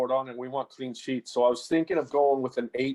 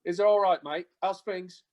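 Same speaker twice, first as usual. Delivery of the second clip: higher-pitched mock English accent; put-on English accent; 6 semitones higher than usual. Shown as a demonstration. American guest showing off his impression to the hosts, in a put-on English accent. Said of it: "my English accent might be a little better".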